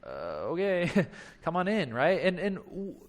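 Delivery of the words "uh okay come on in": hesitant laughing okay. Pretend. Host laughing awkwardly as he lets the friend in. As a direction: give in with an awkward chuckle.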